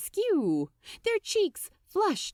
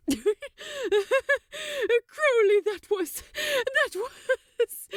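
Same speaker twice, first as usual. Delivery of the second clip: breaking off, high, through laughter; high breathless laughing voice, losing the words; laughing too hard to talk; 14 semitones higher, 4 dB louder than usual. A narrator's reading. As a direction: try to speak but the laugh wins. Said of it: "he had to stop".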